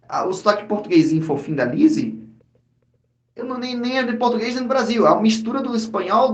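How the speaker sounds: ordinary talk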